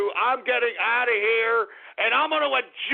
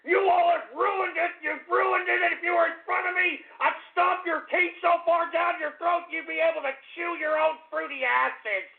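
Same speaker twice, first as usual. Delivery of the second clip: furious rant; 7 semitones higher than usual. Real, not acted. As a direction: rage at them, voice rising. Host rants, threatens callers.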